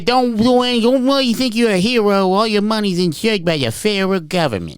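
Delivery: hammed-up high-pitched robber; Little Red James. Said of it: "I would be known as Little Red James".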